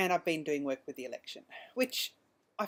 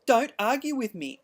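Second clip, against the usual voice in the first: slow, raised scolding from the officer; stern officer; 6 semitones higher, 10 dB louder than usual. Retold or imitated. Officer scolding the upset driver, slow and stern. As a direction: put her in her place, slow and loud.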